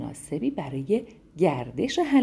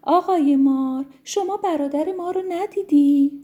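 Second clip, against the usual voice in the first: eager brothers asking; 8 semitones higher, 6 dB louder than usual. Acted. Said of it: "از اون پرسیدن".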